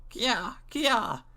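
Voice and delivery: high chattering monkey call. Acted. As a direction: squeak it like a little monkey. Monkey's cry in story.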